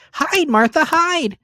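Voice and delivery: put-on very high, urgent children's cry; little ones squealing hide; excited children. A narrator's reading. The young Cratchits urge Martha to hide, high and excited.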